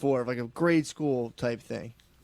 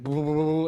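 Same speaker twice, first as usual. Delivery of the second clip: rapid run of louder "boo" thumps; imitated racing heartbeat; 5 dB louder than usual. Dreamer showing his heart racing in astral plane, imitated heartbeat. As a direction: sputter a pounding heart in quick syllables. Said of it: "My heart was like".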